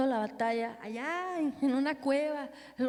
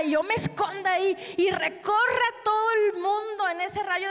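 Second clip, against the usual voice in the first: fiery preaching; 4 semitones higher, 6 dB louder than usual. Acted. Preaching at full force.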